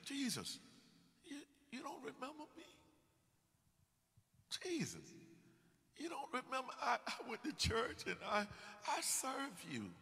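mock desperate churchgoer begging to be remembered; desperate believer. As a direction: plead with Jesus, pitch way up. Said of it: "what they're gonna say to Jesus".

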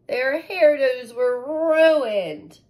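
slow, loud, high lament; dramatic dismay. A narrator's reading. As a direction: stretch it out in mock horror. Dramatic story beat.

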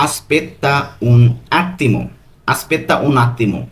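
"Aspetta un attimo" drawn out slowly, "un" stressed; slow model answer. Shown as a demonstration. Teacher slowly revealing the correct answer to the class. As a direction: stretch the correct phrase out for learners. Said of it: "এর সঠিক উত্তর হবে".

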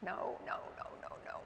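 breathless unvoiced no's, fading to nothing; collapsing into whispered denial; retold anguished no. Hearing the news of a death, whispered broken no. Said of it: "And I start screaming".

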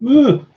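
sudden high, loud ugh of disgust; startled disgusted ugh. Real, not acted. Host feels a spider land on them, startled yelp of disgust.

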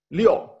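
liù said slowly, sliding down; Mandarin liù. Shown as a demonstration. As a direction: say "lió" like Chinese six, falling tone. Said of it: "se pronuncia lió".

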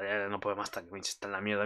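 dropped low and soft, deflated; sinking voice of pity; hushed despair; quiet dismay. Real, not acted. Despairing over beaten hero.

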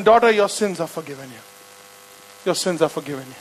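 low, soft and gentle; tender pardon. Retold or imitated. Jesus tells the woman she is forgiven, soft. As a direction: speak it like a blessing.

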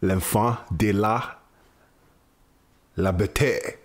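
slow, spaced-out French quotation; solemn recitation. Acted. Closing the podcast with an old French song, solemn recitation. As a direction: recite it grandly, pausing between phrases.

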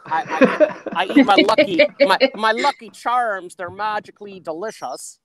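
hammed-up Irish cereal mascot; mock Irish accent. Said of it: "does he do an Irish accent".

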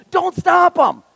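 high, loud rebuke; urgent Jesus. Acted. Jesus telling the disciples to let the kids come, urgent and high. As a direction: press the command hard.

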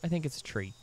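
usual speaking voice

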